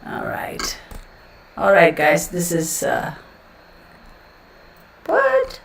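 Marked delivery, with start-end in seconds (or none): 0.1-0.8 soft whispered aside. Real, not acted. Streamer murmuring to herself, whispered. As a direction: breathe it under your breath.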